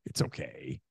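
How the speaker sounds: put-on calm a four-year-old gently; soothing whisper. Parent comforts small daughter mid-tantrum, soothing hush.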